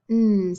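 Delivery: "N" as a long hummed nnn; "N" sustained like a hum; isolated letter sound. Shown as a demonstration. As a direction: hold "N" as a pure sound, not the letter name. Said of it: "two letters that make that N sound".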